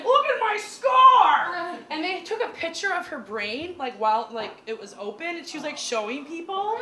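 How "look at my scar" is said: loud, high, slow proud exclaim; proud shout. Retold or imitated. Grandma shouting proudly about her brain surgery scar. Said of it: "And she's like".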